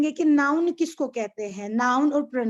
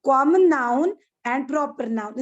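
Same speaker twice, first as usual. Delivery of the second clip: Common noun and proper noun said slowly and louder; slow emphasis; 4 dB louder, slower than usual. Shown as a demonstration. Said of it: "आपको यहाँ पर दो पढ़ने हैं".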